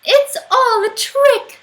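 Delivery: put-on high, loud, slow indignant child; cross child realising the trick, high and loud; child huffing. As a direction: declare it's all a trick. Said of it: "said the Gruffalo's child".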